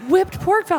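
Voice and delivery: high, loud disbelieving squeal; incredulous. Retold or imitated. Friend retelling a menu, loud shocked disbelief. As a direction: shriek it in disbelief.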